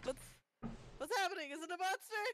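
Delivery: startled alarm. Acted. Player as someone roused by an awful noise, alarmed. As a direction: wake up in a mock panic. Character woken by knocking and shriek.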